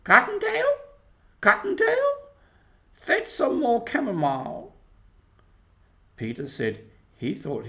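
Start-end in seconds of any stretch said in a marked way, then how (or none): 0.0-4.7 put-on high, loud call into the burrow as old Missus Rabbit. A narrator's reading. Missus Rabbit calling Cottontail from inside the hole, high and loud.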